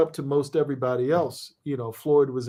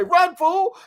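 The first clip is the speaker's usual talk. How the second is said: much higher, loud and punchy; energetic shout; 5 dB louder than usual. Shown as a demonstration. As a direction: yell it like a seventies sitcom line. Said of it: "But if you say".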